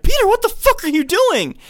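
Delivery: high, loud startled shout; mock shocked yell. Classmates shouting at Peter slamming his face, shocked yell. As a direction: yell it in alarm, hammed up. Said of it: "everyone's like".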